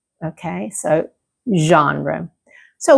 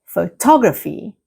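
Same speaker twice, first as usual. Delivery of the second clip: photography stressed on TOG; careful model photography with the beat on TOG; second-syllable stress. Shown as a demonstration. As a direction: hit the second part, pho-TOG-raphy. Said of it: "the stress moves to the second part".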